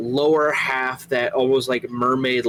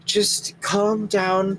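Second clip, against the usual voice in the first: calming sister; 7 semitones higher, much slower than usual. Acted. Abby calms Pearl.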